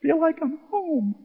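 high, choked-up reenactment; voice cracking. Retold or imitated. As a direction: act out a voice breaking with emotion. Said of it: "my voice is cracking".

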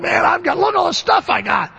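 high, delighted shout over the loot; excited gloating. Retold or imitated. Freed Israelites showing off their loot, excited shout. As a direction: crow about the haul like a kid with a full cart.

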